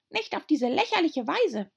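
very high tiny squeak; squeaky little dormouse. A narrator's reading. Dormouse voiced.

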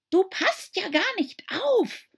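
high, stern scolding mouse. A narrator's reading. Mouse to Alice, stern scolding. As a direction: scold her for drifting off. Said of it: "sagte die Maus streng".

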